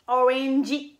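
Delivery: orangey in separate beats, "o-ren-ji", ending on a clear ee; I final ajouté. Shown as a demonstration. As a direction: add a Japanese-style "ji" to the end of orangey. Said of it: "ils le déforment en mettant un I". (orangey à la japonaise, « o-rènn-dji », avec un i final)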